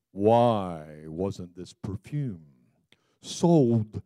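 lightly voiced, low and slow sanctimonious complainer; a hint of a whiny self-righteous critic; snooty Judas voice. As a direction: Judas objecting to the perfume, a touch of prissy disdain. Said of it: "that's kind of the way he talked".

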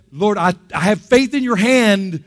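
loud, high declaration of faith; fervent prayer. Acted. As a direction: cry out to the Lord with conviction. Acted fervent prayer to the Lord.

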